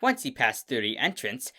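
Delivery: plain reading voice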